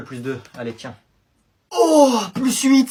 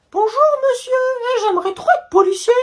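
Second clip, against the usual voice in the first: mock sweet kid; 13 semitones higher than usual. Kid fakes politeness to cop.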